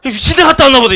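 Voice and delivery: 아주 높고 크게 놀라 소리치기; 기겁하며 소리 질러. Retold or imitated. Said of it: "이래, 이래". (변한 친구를 보고 기겁하는 사람들의 큰 외침)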